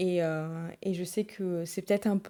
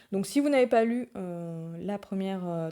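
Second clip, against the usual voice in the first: ordinary talk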